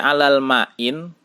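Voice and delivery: "alal ma'in" closed with an added n; wrong tanwin ending. Shown as a demonstration. Teacher warning against tanwin, "alal ma'in" with final n. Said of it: "tidak dibaca dengan tanwin".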